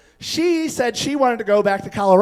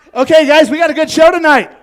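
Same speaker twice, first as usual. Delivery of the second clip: higher and louder, calling out to the room; hyped MC callout; 6 semitones higher, 10 dB louder than usual. Real, not acted. Comic handing off the show to the audience, loud and bright. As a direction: pump up the crowd. Comic hands over to the crowd.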